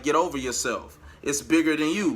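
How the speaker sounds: usual speaking voice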